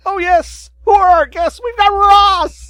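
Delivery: high, loud, slow hype; excited. Acted. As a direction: announce the guest like a game show. Host hyping the guest.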